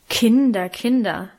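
mild, reproving appeal; admonishing. A narrator's reading. Brother-in-law steps between quarrelling children, mildly admonishing. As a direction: chide gently, stepping down on the second word. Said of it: "mahnte er".

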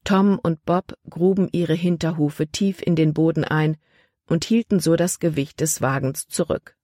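usual speaking voice

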